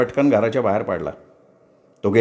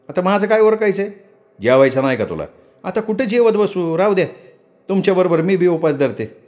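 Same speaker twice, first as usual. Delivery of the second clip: higher, impatient village woman's voice; young wife Ratna; 5 semitones higher than usual. Acted. Wife Ratna hurrying her husband to market, impatient. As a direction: nag him to stop preening.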